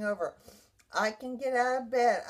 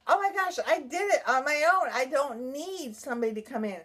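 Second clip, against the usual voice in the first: triumphant excitement; 3 semitones higher than usual. Retold or imitated. Survivor celebrating vacuuming on her own, triumphant excitement. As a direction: exclaim it in amazed pride.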